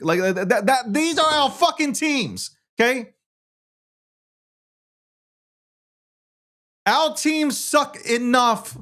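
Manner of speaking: New York fan ranting about tanking; worked-up rant. Real, not acted.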